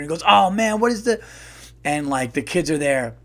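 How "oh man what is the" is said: high, loud, urgent puzzling; worked-up guessing; straining to remember. Acted. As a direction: act out a stepfather racking his brain. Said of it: "He's trying to figure".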